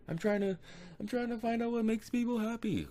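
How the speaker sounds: very high-pitched mock panic; retold freak-out. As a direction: squeal the answer like a terrified captive. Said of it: "He's, like, freaking out".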